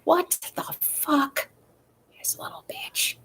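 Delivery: slow, disbelieving, dropping to a breathy whisper; mock shocked former owner. Imagining the dog's old owner reacting in slow mock disbelief. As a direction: play the old owner stunned and muttering. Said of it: "she'd be like".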